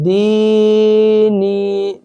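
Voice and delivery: دینی with the final نی held long and slow; slow recited دینی with long نی; drawn-out madda. Shown as a demonstration. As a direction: stretch the ی in دینی like a long vowel. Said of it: "تو یہ نون کو یا کے ساتھ ملا رہا ہوں نا میں".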